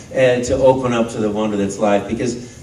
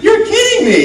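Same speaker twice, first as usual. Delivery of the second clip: mock disbelief; 6 dB louder than usual. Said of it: "and I'm like".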